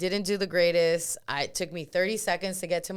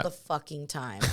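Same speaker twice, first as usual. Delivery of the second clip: normal talking voice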